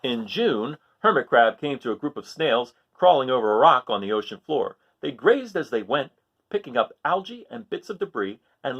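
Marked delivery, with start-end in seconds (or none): none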